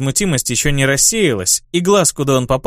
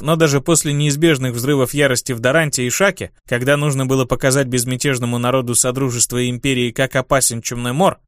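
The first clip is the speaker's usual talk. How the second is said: ordinary delivery, nothing marked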